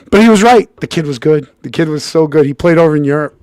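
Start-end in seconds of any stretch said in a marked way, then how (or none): none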